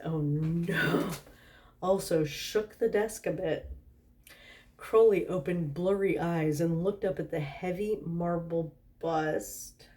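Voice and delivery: plain reading voice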